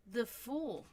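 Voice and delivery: ordinary talk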